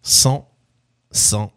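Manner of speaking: halting, low spoken lyrics, words set apart; lyric recitation. Acted. Quiz host teasing out song lyrics, paused recitation.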